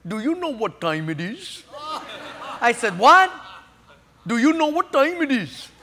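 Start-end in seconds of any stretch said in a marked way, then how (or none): 0.1-1.6 lightly voiced East Indian accent, amused; a hint of the East Indian gate agent. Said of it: "East Indian accent". 3.0-3.3 loud, high, sharp what; shocked by the question. Retold or imitated. Shocked reply. 4.3-5.7 lightly voiced East Indian accent, amused; a hint of the East Indian gate agent. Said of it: "East Indian accent".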